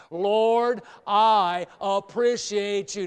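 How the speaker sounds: "Lord" called out loud, phrase slow; loud slow prayer phrase. Shown as a demonstration. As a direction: lead the church saying it loud. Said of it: "Let's say it".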